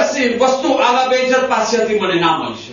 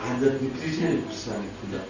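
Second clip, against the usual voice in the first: hushed, much softer and lower; in the mind; 8 semitones lower, 12 dB quieter than usual. Retold or imitated. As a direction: say it inwardly, barely audible. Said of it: "મનમાં". (મનમાં ધીમે બોલવું)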